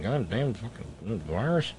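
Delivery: low, slow, soft grumble; muttered curse. Real, not acted. Host cursing the virus in an aside, muttered. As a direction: grumble it under your breath, slowly.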